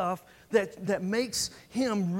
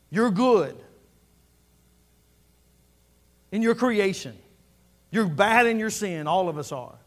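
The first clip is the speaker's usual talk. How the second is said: louder and louder, the preacher building his list of God's deeds; preaching at full force; 7 dB louder than usual. Acted. Preacher to his church, proclaiming how big God is, at full force. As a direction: thunder it out like a revival preacher, slowing on the last lines.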